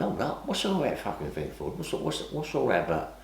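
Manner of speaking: a hushed, wary retold confrontation at the table; hushed retold challenge. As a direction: mutter the challenge under your breath. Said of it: "I went".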